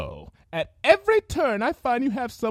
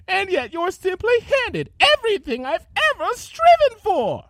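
high, loud bitter tirade; jealous rant; 5 dB louder than usual. Acted. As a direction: rant with rising fury. Rarity ranting in jealousy.

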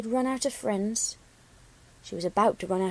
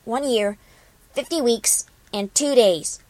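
quick, loud retort with desperation; a touch of desperate defiance toward her friend; snapped back; 5 dB louder than usual. A narrator's reading. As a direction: snap the count back at once. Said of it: "answer was snapped back in an instant".

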